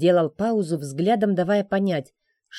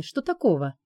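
usual speaking voice

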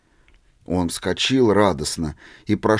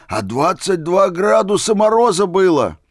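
much higher, loud outburst; rapturous shout; 8 semitones higher, 6 dB louder than usual. A narrator's reading. Old man shouting in delight at barefoot Misha. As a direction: burst out in delight. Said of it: "восторженно закричал старик".

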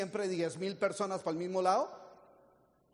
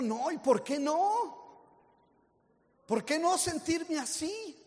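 slow, higher, mock-indignant; defiant reply; 7 semitones higher, slower than usual. Answering the friend, slow mock-indignant. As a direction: stretch it out, playfully defiant.

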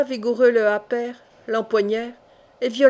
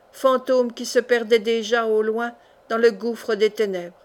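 plain reading voice

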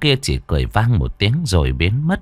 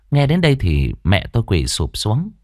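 ordinary delivery, nothing marked

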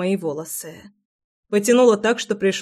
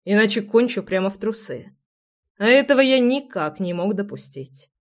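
ordinary delivery, nothing marked

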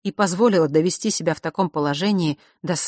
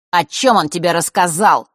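menacing demand; 8 dB louder than usual. A narrator's reading. Nort demanding from Vasilisa.

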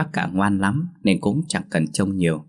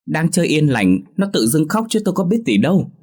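much higher, louder whiny protest; aggrieved reply; 9 semitones higher, 4 dB louder than usual. Acted. Grandfather protests to grandmother he did nothing. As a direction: defend yourself, feeling wronged. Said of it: "ấm ức ... đáp lại".

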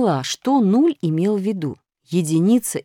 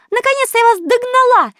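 breathless arrival; 8 dB louder than usual. A narrator's reading. Единица влетает запыхавшись.

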